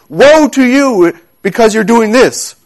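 high-pitched, emphatic prophetic warning. Acted. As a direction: warn a whole people, drawing out "Woe". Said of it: "sent from God to warn the children".

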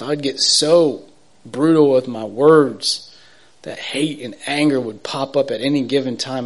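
ordinary delivery

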